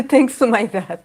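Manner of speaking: laughing through Thanks to my dad. Real, not acted. Joking that her dad spoiled her, said with a laugh. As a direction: blame dad while giggling.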